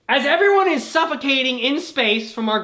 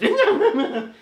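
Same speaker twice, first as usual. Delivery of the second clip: manic giggle; 11 semitones higher, 4 dB louder than usual. Acted. Strange being cackling in corner.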